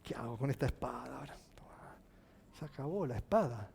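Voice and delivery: fast unvoiced whisper; whispered thought. [name] wonders what to do with his sword, whispered. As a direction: breathe it out like a private doubt.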